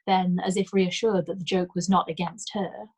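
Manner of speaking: usual speaking voice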